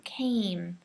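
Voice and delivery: Came with a long, strong A sound; whispered Came, long A. Shown as a demonstration. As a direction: stretch the A in Came. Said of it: "make sure that A is really strong and long".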